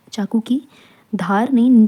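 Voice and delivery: normal talking voice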